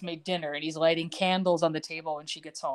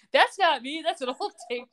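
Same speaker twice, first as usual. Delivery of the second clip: higher-pitched imitation of lame excuse; mock protest; 7 semitones higher than usual. Podcaster mimics cheating husband denying the tape, mock protest. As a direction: plead an excuse in mock defence.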